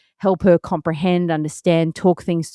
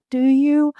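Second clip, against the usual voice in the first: excited parent; 8 semitones higher than usual. Retold or imitated. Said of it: "I'm like".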